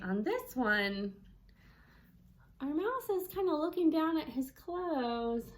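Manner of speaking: ordinary talk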